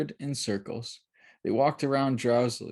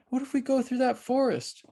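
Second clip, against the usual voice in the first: put-on girl voice; 14 semitones higher than usual. A narrator's reading. Child Mercy suggests.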